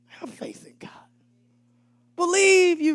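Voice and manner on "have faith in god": low whisper, almost no voice; hushed whisper of God's word; whispered command. Retold or imitated. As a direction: breathe it as a holy secret.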